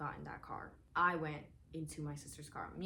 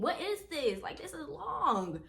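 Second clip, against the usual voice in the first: higher and louder, incredulous scolding; mom scolding; 9 semitones higher, 6 dB louder, slower than usual. Retold or imitated. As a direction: mom staring at the long ticket, raised voice. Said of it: "she was like".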